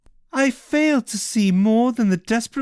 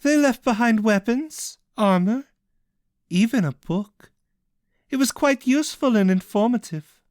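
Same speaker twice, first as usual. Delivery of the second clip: higher, lightly voiced taunting; mocking villainess; 8 semitones higher than usual. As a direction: offer it with false kindness. Cassian taunts Ishel.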